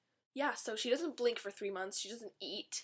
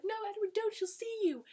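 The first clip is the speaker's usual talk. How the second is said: much higher, panicked Bella; mock Bella panic; 11 semitones higher than usual. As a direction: squeak like frantic Bella. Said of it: "She's like".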